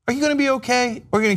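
mock concern. Mock comforting crying child.

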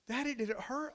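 put-on high, childlike question; high-pitched child's voice; little girl. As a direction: ask Daddy as a worried small child. Little girl questions her father.

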